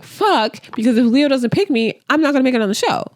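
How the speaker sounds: high-pitched imitation of panicked complaining; mock spiraling. Imitating Hannah fearing she quit her job, mock spiraling. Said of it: "Her spiraling kinda makes sense".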